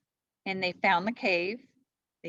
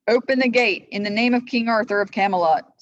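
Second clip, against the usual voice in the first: much louder commanding call; 4 semitones higher, 10 dB louder than usual. A narrator's reading. Sir Lancelot orders the guards to open the gate, loud. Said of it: "Sir Lancelot called".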